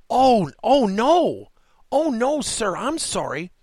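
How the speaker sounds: high mock-dismayed "oh no", easing into a drawn-out apology; mortified apology. Caught clerk apologising to a customer, mortified and high. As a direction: imitate a flustered clerk, high-pitched.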